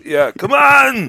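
high, loud, drawn-out Austrian bellow; Arnold Schwarzenegger. Acted. Trading movie quotes with co-host, bellowed Schwarzenegger impression. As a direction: roar it slowly like an action hero. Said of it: "Arnold Schwarzenegger".

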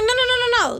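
high, retold protest; insistent protest. Telling a stranger he's wrong about the gas, high insistent protest. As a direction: rush in with high-pitched denials.

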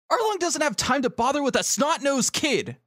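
high-pitched character voice; dismissive Nami. Acted. Voicing Nami scolding the kid.

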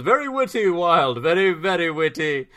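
lightly pompous royal compliment; prince voice. Retold or imitated. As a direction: ham up a stuffy prince. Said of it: "The prince responds".

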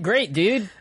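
ordinary talk, nothing marked

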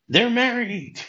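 high-pitched mock yell at normal volume; squealed announcement, played for fun; mock scream. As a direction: imitate someone shrieking out big news. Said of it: "then Billy just screams out".

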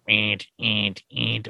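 low mechanical toy whirr, pulsed; imitated robot noise. Remote control Frankenstein walking really slow, imitated toy noise. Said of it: "this thing's walking".

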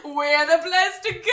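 loud, high mock advert cry; mock Bali ad. Playing Bali as a cheerful tourist ad, loud and high. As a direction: shout it like a cheesy holiday ad. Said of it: "Bali's like".

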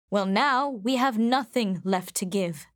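ordinary delivery

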